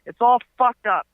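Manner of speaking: high, hammed-up threats; mock threatening rant. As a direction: threaten like a blustering landlord. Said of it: "He's like".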